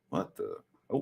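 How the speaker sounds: plain reading voice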